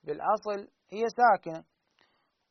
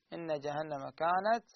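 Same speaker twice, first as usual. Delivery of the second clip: usual speaking voice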